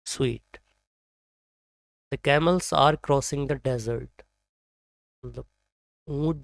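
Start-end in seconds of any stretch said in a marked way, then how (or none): none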